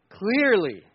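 ordinary talk, nothing marked

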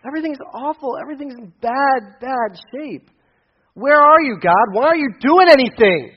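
high, pleading voice climbing to a shout; prophet's complaint, rising and loud; crying out. Retold or imitated. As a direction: cry out to God in frustration. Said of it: "Habakkuk was crying out".

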